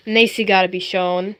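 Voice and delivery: ordinary talk, nothing marked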